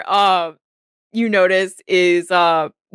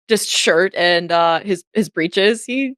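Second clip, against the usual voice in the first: normal talking voice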